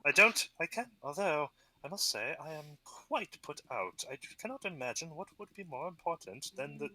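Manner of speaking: a hint of a refined goblin chief; lightly voiced goblin chief. Goblin chief pleased the hero speaks Goblin, lightly voiced. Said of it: "he's like".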